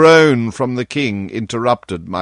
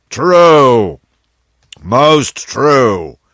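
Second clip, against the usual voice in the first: louder, snapping in to stop him; abrupt interruption; 3 semitones higher, 7 dB louder, much slower than usual. Acted. King interrupting Lord Hertford, loud and abrupt. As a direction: cut in loudly, agreeing. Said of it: "interrupted the king".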